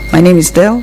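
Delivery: ordinary talk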